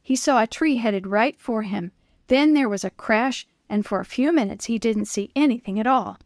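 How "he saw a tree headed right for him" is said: plain reading voice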